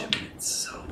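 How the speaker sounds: hushed, voiceless tail of a secret; whispering. Pretend. Player confides in Rock about the shipments, whispering. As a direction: finish the confidence under your breath. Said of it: "I'm going to whisper in the ear".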